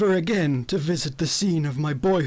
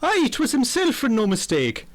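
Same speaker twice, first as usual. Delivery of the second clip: higher Irish gardener; cheerful Irish brogue, pitched up; Irish accent; 5 semitones higher than usual. Acted. The Irish gardener vouches for Nigel, Irish accent. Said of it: "O'Toole, the gardener".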